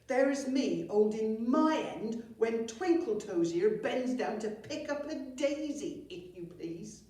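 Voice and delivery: loud, slow accusing goblin; huffy goblin telling tales; indignant goblin. Acted. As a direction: tell on your brother loudly and slowly. Goblin twin accuses brother.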